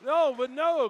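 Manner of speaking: shouted high play-by-play; wrestling commentator. Real, not acted. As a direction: call the big move. Live call of top rope move.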